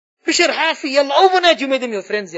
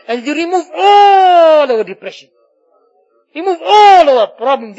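impassioned plea; 4 semitones higher, 6 dB louder than usual. Real, not acted. Imam begging Allah to lift depression, impassioned plea. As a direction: cry out for relief, stretching the key word. Crying out for relief in prayer.

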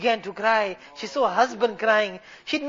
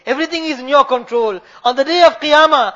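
earnest supplication that ebbs and swells; heartfelt pulpit prayer with emphatic calls; fervent preaching; 5 dB louder than usual. Real, not acted. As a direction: plead to Allah on the congregation's behalf. Preaches throughout.

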